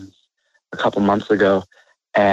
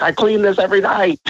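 mock indignant chef; 10 semitones higher than usual. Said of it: "always gets mad at me".

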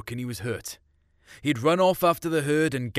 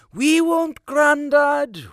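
put-on much higher, louder teasing girl voice; cheeky girl; 14 semitones higher, 9 dB louder, much slower than usual. Blonde girl teasing the old farmer, much higher and louder. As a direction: tease him like a saucy young woman winking. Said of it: "Blondie said".

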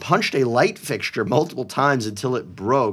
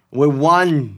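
loud bad Boston accent; hammed-up Boston fan shout; 4 semitones higher, 6 dB louder than usual. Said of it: "mine's awful".

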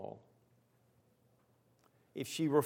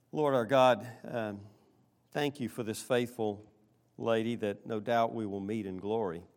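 earnest, measured prayer voice; closing prayer; 3 semitones lower than usual. Acted. Pastor closing the sermon by praying for the faithful lady, earnest prayer. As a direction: speak up to God for the congregation.

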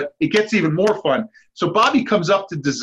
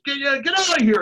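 higher mock girl yelling through a door; lightly imitated girl shooing him off; a hint of an annoyed girl; girl's voice; 9 semitones higher, faster than usual. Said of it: "he hears, like, a girl's voice".

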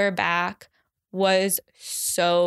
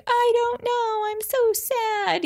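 much higher, hammed-up teary voice; mock sobbing whine. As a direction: whimper it like a self-mocking cry, much higher. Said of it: "was sobbing".

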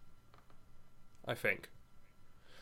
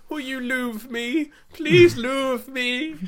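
much higher, very slow pleading squeak; pleading hobbit voice; 14 semitones higher, 7 dB louder, much slower than usual. Pretend. Host imagines a tiny hobbit begging people, a high slow pleading squeak. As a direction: beg like a tiny pleading creature.

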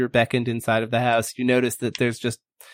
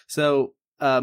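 normal talking voice

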